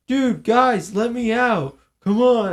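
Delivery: high, loud begging to be let out; pleading yell. Retold or imitated. Kid trapped in bus cage begging classmates, loud pleading yell.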